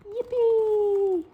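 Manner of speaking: very high, loud squeal of delight; joyful cheer. Acted. Sally catching the airmail, very high and loud cheer. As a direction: burst out with glee.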